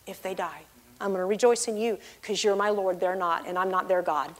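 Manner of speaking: soft, low, retold vow; subdued tearful resolve. Believer promising God to rejoice through tears, soft and subdued. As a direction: vow it quietly, as if after crying. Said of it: "I was crying".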